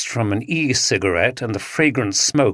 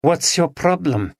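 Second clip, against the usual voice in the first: put-on cool East European accent, pitched higher; 7 semitones higher than usual. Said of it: "in her East European accent".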